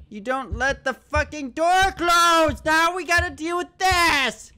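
high-pitched panicked rant; worked-up, rising complaint; exasperated yelling. Real, not acted. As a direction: scold yourself loudly, climbing in pitch. Ranting at himself over closed door.

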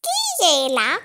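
the wolf plays granny; wolf faking grandmother. A narrator's reading. Wolf in bed pretends to be the grandmother, high and loud. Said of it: "en imitant la voix de la vieille dame".

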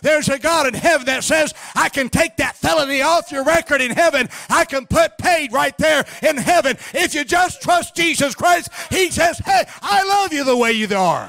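high, forceful revival sermon delivery; fervent preaching. Real, not acted. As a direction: drive it home to the congregation, drawing out key words.

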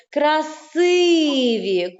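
КРАСИВІ with a long, stretched, stressed И. Shown as a demonstration. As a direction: hold the middle И of КРАСИВІ. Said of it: "поставимо наголос у слові". (КРАСИВІ з протяжним наголошеним И)

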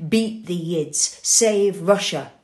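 loud, slow, hammered-out mob cry; a bully roaring slogans; jeering shout. Acted. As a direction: bellow it like a pogrom bully. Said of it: "and shout".

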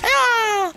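very high, held scream; sustained high-pitched "ah" cry. Real, not acted. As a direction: scream out on one high note. Hit in game.

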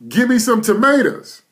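loud, high demanding yell at the ground; a pushy gardener barking orders at the soil; mock demand. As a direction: shout it like you're ordering the dirt around.

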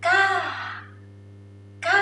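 "ka" starting high, then sliding down; falling tone. Shown as a demonstration. Teacher reading the marked word, falling-tone "ka". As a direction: let "ka" fall away from high. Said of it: "Falling tone on top".

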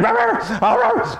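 very high yappy mock bark; taunting dog yap. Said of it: "they come back and".

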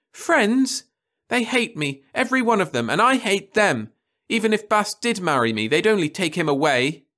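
high, loud scornful outburst; bitter girl. A narrator's reading. Daughter scorning her office friends, high and loud. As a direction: spit out how she hates them all.

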